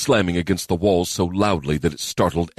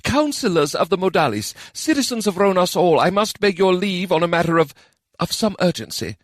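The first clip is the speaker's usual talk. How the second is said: formal keeper; 13 semitones higher than usual. Acted. Keeper addresses council.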